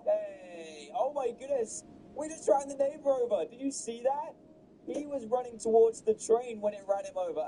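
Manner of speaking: amazed but held back; excited yell at normal volume. Real, not acted. Gamer reacting to the neighbor hit, excited cries. Same clip, replayed take.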